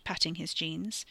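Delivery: plain reading voice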